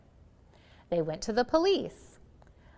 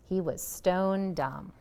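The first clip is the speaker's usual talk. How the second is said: plain reading voice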